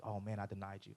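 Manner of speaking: hushed, low confession of guilt; imitating quiet, sinking remorse; acted shame. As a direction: drop to a soft, ashamed aside. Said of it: "The hope and the shame".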